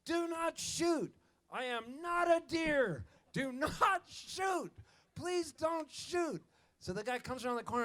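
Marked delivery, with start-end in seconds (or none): 0.0-6.4 high, slow pleading voice; high, slow begging plea from the bushes; deer-man voice from the bushes. Acted. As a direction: beg them not to shoot, drawn out. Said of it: "and the voice says".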